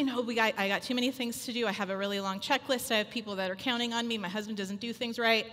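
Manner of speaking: fast, rattled-off busy-mom list; hurried anxious checklist; frazzled excuses. Retold or imitated. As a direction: gabble the reasons you can't stop. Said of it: "And we say".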